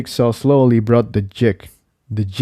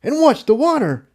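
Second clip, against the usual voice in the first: higher character voice, urgent call, stress on over; shouted; 14 semitones higher than usual. A narrator's reading. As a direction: call it across the cavern, mildly raised. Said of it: "Jigsaw shouted".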